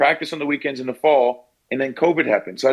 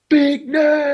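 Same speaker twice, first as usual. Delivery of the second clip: high, loud drawn-out call; hollered greeting impression; 14 semitones higher, 5 dB louder than usual. [name] greeting him every time they meet, hollered excitedly.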